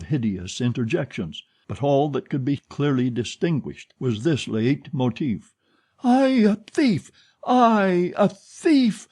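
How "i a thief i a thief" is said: put-on high, loud, strangled old woman's cry; furious, sputtering disbelief; choking with rage. Accused of theft, the old woman chokes with rage. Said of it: "She choked with rage".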